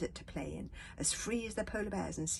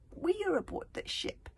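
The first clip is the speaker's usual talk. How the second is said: pointing out; 13 semitones higher, 4 dB louder than usual. A narrator's reading. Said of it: "Bear Cub pointed out".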